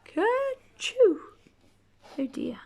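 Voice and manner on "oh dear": ordinary delivery, nothing marked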